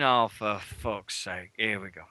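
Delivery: low, soft, slow exasperated groan of the words; weary resignation. Retold or imitated. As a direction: sigh it out, fed up with them. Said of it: "I'm stood there going".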